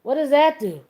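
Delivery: mock innocent question. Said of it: "He's like".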